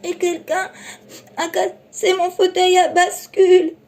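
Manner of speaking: high, loud tearful little bear; Baby Bear over his broken rocker, tearful and high; crying baby bear. Acted. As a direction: wail like a tiny bear cub. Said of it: "en pleurant".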